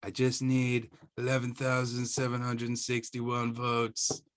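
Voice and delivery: slow, deliberate mock Trump voice; imitating Trump. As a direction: drawl out the number like a pushy demand. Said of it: "where he's like".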